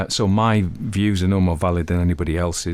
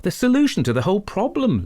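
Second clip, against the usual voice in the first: higher, bright eager jump in; happy; 13 semitones higher than usual. Acted. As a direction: Lucas cutting in, pleased. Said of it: "Lucas intervened happily".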